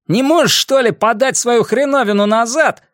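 high, irritated yell at another driver; ругань водителя. A narrator's reading. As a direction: curse at a driver in the jam, high and strained. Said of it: "далеко разносились ругательства". (раздражённая громкая ругань)

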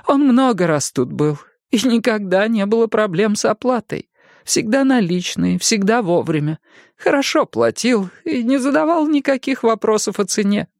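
высокий голос пожилой женщины; мягкая разговорчивая старушка. A narrator's reading. Jenny answers. (голос старушки)

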